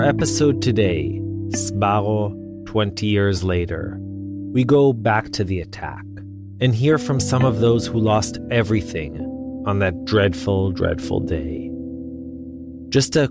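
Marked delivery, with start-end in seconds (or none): none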